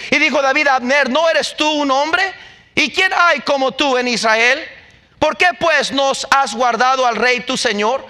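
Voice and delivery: preacher reading scripture to the church with mounting fervour; fervent scripture reading. Acted. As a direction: proclaim the verses like a preacher in full flow. Reads scripture with rising fervour.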